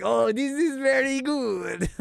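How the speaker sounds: slow, high mock Russian accent. The old Russian dude enjoying the prank, mock Russian accent. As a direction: delight in a put-on Russian accent. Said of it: "They were like".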